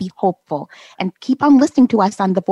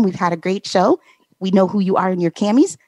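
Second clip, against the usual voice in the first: normal talking voice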